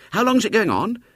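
a keen schoolboy hailing a stranger; eager boy. Acted.

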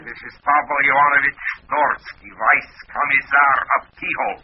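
put-on high, pompous comic Russian accent; comedy Russian accent. Caller posing as a commissar at her door, comedy Russian accent. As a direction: announce yourself in a hammed-up Russian accent. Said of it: "What's the idea of the comedy accent?".

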